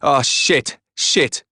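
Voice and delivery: high horrified cursing; crying out. A narrator's reading. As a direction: cry out at blood on the hands. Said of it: "I cried".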